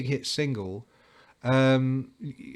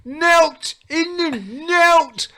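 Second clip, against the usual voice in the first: put-on much higher and louder broad Midlands accent; 14 semitones higher, 8 dB louder than usual. Guitarist ranting about money to the journalist in a Midlands accent. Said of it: "Midlands accent".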